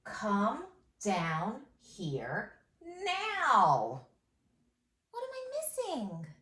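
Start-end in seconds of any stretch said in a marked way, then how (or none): 0.0-4.0 slow dictation. Shown as a demonstration. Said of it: "dictating slowly".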